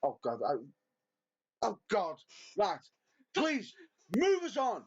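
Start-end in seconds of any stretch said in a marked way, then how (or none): none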